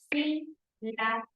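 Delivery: "sílaba" in three separate beats, sí, la, ba; split into syllables. Shown as a demonstration. Teacher showing three voice beats in "sílaba", syllables separated. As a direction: pause after each syllable of "sílaba". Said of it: "Tres golpes de voz".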